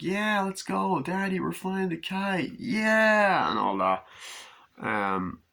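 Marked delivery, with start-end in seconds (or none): 0.0-3.5 put-on high excited little-kid voice; squeaky toddler cheer. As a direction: play the eager child at the picnic. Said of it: "They're like".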